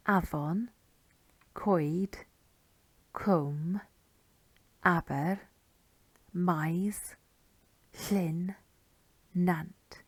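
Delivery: each Welsh word said alone with a pause after it; word list read out. Shown as a demonstration. Teacher reading place-name parts for learners, spaced and distinct. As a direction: read out "Afon, Coed, Cwm" and the rest one by one. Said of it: "I'll read them out".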